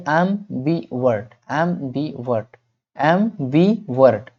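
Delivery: एमबीवर्ट slowly as am - bi - vert, each time; syllable-by-syllable. Shown as a demonstration. Teacher repeats the word for class, एमबीवर्ट slowly broken up. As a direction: drill एमबीवर्ट with a gap between the parts. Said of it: "pronounce करने में इस तरह से".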